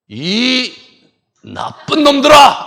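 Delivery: slow, high, very loud rebuke; acted angry shout. Preacher imagines Jesus toppling his accusers, acted angry shout. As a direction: draw out the first word, then shout it.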